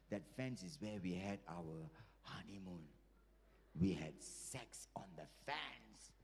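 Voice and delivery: low, soft, drawn-out recollection; slow reminiscing. Acted. Grandmother recalling honeymoon to grandfather, slow and low. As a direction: linger slowly over a fond memory.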